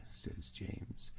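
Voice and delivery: ordinary delivery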